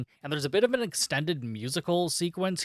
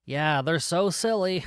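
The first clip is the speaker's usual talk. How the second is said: ordinary delivery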